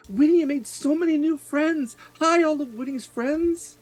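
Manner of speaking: put-on high childlike greeting; little sea elf girl. Sea elf child greeting Winnie's friends, high and eager. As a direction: squeak it out like a small kid. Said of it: "she looks around, she goes".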